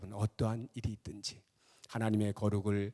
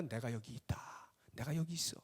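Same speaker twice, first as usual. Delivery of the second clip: softly spoken words of God; gentle reassurance; 7 dB quieter than usual. Acted. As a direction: drop to a soft, reassuring voice. God answers trembling, doubting people. (나직이 안심시키는 목소리)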